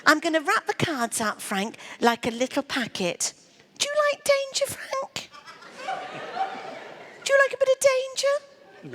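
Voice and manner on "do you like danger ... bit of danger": teasing high sing-song. Acted. Teasing volunteer about danger.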